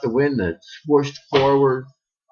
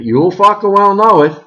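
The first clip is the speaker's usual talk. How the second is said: threatening; 8 semitones higher, 7 dB louder than usual. Acted. Janet threatens the household.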